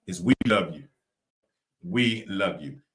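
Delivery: ordinary talk, nothing marked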